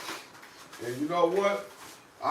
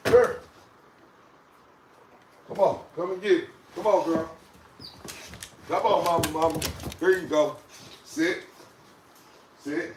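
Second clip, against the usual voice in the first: coaxing call; 4 dB louder than usual. Real, not acted. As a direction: lure her over sweetly with a treat. Coaxing the dog with a treat.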